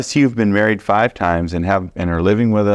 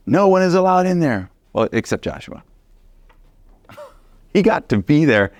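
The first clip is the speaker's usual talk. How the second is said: amazed; 9 semitones higher than usual. Real, not acted. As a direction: marvel that only one man was let in. Marvelling at Joshua's access.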